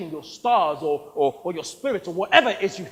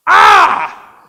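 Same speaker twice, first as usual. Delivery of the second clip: much louder and higher furious yell; furious shout; 8 semitones higher, 11 dB louder than usual. Acted. Narrator shouting at the producer who mangles his name. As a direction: scream your name back at him. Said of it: "My eyes went red".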